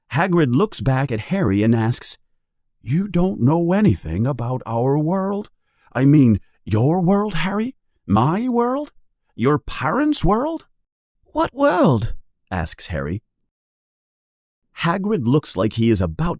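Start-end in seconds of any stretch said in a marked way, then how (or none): 2.8-10.7 high, building giant's disbelief; pressing harder each question; rising disbelief. Acted. Hagrid questioning Harry about his world, high. 11.3-12.1 put-on high boyish question; a confused boy asking; puzzled boy. Harry baffled by the giant, boyish high.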